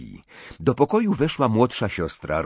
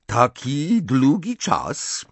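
slow, higher, throaty foreign lilt; 7 semitones higher, 4 dB louder, much slower than usual. A narrator's reading. Fleur greets Harry after a long absence, throaty. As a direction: draw each word out gutturally. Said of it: "powiedziała gardłowym głosem".

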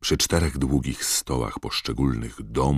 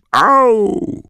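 loud, high "ow" yelp; yelp of pain; 11 dB louder than usual. A narrator's reading. Ron hit by a water balloon, yelping loudly. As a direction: yelp as something hits your head.

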